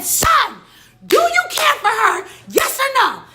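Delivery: very high, loud angry yell; re-enacted furious outburst at full pitch; mad shouting. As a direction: explode into a shouted demand for an answer. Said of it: "Then I start getting mad".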